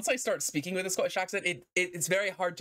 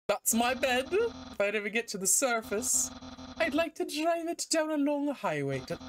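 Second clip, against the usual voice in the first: higher, wistful character voice; theatrical, raised-pitch daydreamer; dreamy game character; 6 semitones higher than usual. Acted. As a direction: play a character lost in a daydream.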